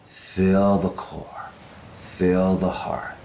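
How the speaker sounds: spaced, rhythmic breathing cues; steady breath coach counting the rhythm; guided breathwork cueing. Shown as a demonstration. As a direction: lead the breath with measured single cues, falling away on each out-breath. Leads rhythmic breathing exercise.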